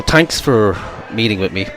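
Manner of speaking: normal talking voice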